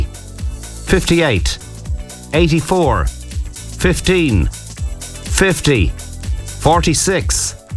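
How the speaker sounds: slow, drawn-out number calling; bingo caller. Acted. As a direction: call each number like a bingo hall.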